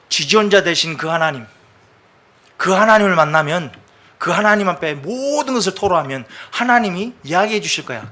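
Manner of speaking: loud, rising preacher's proclamation; fervent preaching. Acted. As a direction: proclaim it to the whole church. Preaching climax to congregation. (회중에게 선포하듯 힘차게 설교)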